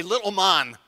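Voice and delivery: loud mock-Scottish lilt; Scottish accent. Shown as a demonstration. Preacher showing congregation his "little man", Scottish accent. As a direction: say it like a Scot. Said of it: "of a Scottish accent when I say".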